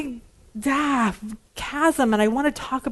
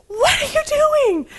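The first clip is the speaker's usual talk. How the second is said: high-pitched frightened cry, slow and louder; panicked yell; 4 dB louder, slower than usual. Retold or imitated. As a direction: shriek it at the driver in panic. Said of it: "So I tried not to scream, but I was like".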